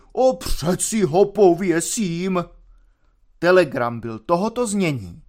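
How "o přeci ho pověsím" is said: slow, drawn-out raised cry; shouted threat. A narrator's reading. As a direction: cry it out slowly, every word stretched. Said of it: "porážel židle a taburetky a křičel".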